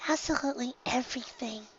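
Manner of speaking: high, soft, eager; shy pony eager to hear everything; brightly. A narrator's reading. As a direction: say it with quiet delight. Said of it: "Fluttershy said brightly".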